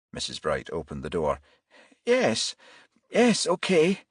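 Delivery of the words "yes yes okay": nervous. Acted. Nervous mother letting police in.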